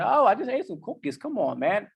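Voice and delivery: high wheedling plea; playful whine of a craving brain. Retold or imitated. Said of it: "Plucks you in the back of the head".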